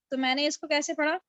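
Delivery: usual speaking voice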